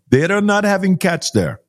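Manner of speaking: a hint of the driver's harsh accent; Indian accent again. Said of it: "his accent was so harsh".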